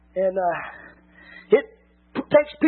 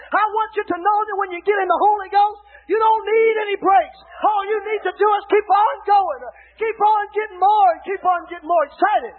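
preaching at full force; 4 semitones higher than usual. Acted. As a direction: drive it home to the pews. Preaching climax on Holy Ghost.

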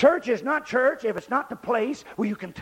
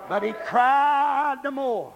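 "cried" drawn way out; stretched CRIED; 3 semitones higher, much slower than usual. Real, not acted. Preacher retelling the blind man's story, stretched "cried". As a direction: hold "cried" long and let it ring. Acts out the crying out.

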